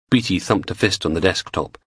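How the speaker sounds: usual speaking voice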